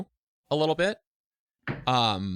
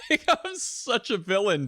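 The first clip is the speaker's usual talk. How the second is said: laughing; 7 semitones higher than usual. Real, not acted. Laughing at himself.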